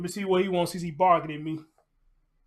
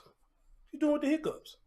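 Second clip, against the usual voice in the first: higher, cooing voice for the dog; 10 semitones higher, 3 dB quieter than usual. Real, not acted. Talking to hiccuping puppy.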